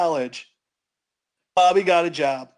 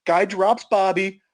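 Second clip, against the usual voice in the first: plain reading voice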